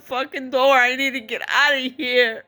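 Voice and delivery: high, tearful cry for help; real anguish, pitched high; desperate pleading. Real, not acted. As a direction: beg a stranger urgently through tears. Begging a bystander for help, in tears.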